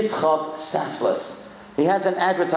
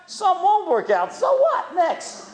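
cheery advice; 10 semitones higher than usual. Retold or imitated. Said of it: "you know what I said to them?".

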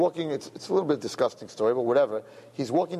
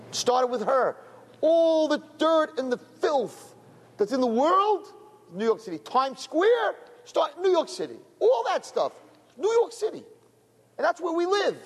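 preaching; 12 semitones higher than usual. Acted. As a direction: pitch climbing high into a fiery sermon. Rabbi preaching at rising force.

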